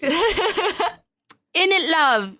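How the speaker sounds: put-on British accent, lilting up on the first words. Shown as a demonstration. Showing off the best British accent to a co-host, playful British accent. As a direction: do a chatty Londoner, British accent. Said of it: "I can do a much better British accent".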